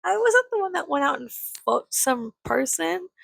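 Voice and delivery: retorting to mom. Retold or imitated. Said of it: "I was like".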